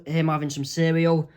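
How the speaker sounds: ordinary delivery, nothing marked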